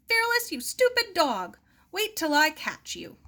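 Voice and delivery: very high, loud exasperated yell; furious at the runaway dog; high, loud angry shout on the run. Acted. Angry chase after dog.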